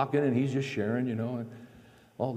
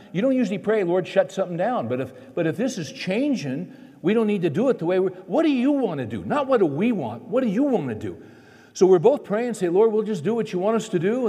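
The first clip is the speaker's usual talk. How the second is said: loud, high, fervent preaching; rising preacher's fervour; 7 semitones higher, 5 dB louder than usual. Acted. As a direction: build the sermon to full force. Preaching builds to full force.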